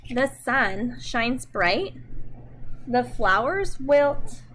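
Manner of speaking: slow, expressive storybook reading; reading to children. Acted. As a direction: read it gently to little kids, taking your time. Reads picture book to children.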